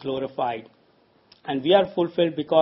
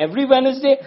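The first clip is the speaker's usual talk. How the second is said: high, loud, outraged question; indignant disbelief; 9 semitones higher, 7 dB louder, much faster than usual. Retold or imitated. Workers objecting to one working day, loud and indignant. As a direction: sound scandalised at working even once a week. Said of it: "They said".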